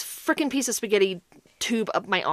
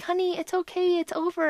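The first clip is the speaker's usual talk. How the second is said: reassuring; 7 semitones higher, 4 dB quieter than usual. Retold or imitated. Nurse comforting the crying patient.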